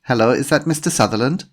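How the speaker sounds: polite phone greeting with a hint of German; slight German accent. A narrator's reading. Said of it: "said a woman with a slight German accent".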